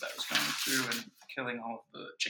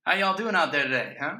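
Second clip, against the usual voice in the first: booming radio host greeting the listeners; loud, showy on-air announcer; 4 semitones higher, 10 dB louder than usual. Pretend. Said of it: "gotta have my radio announcer voice on".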